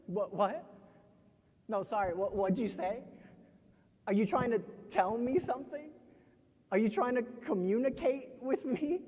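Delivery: halting, exaggerated questions that settle into a dismissal; uncomprehending listener. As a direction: act as if you cannot understand them, condescendingly. Uncomprehending listener figure in poem.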